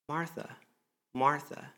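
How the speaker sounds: soft, tender calling of her name; gentle call. Acted. Jesus calling Martha by name, softly tender. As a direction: draw her in quietly. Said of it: "He says".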